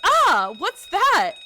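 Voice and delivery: sudden high yelp of alarm; startled. Real, not acted. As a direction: jump at the noise.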